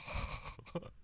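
long soft sigh of shame; deep sigh. Retold or imitated. Warned employee sighing in shame, very soft. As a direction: breathe out the embarrassment. After warning from supervisor.

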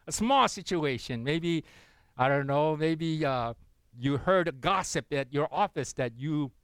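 normal talking voice

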